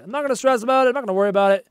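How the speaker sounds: ordinary talk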